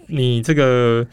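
ordinary talk, nothing marked

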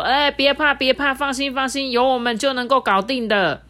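loud, high, hearty encouragement; rally them with a big voice; upbeat workmen cheering everyone on. Acted. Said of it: "弟兄们就为大家打气啊".

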